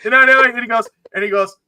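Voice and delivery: usual speaking voice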